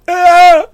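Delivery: high piercing shriek; sudden scream. Acted. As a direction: yelp out sharply. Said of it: "make a noise like".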